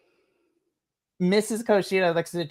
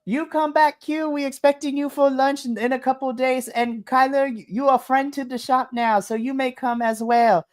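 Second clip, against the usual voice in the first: high, raised calling voice; 7 semitones higher than usual. Acted. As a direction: call after them, lightly raised. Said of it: "Missus Koshida yells".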